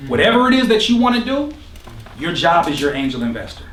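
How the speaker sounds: ordinary delivery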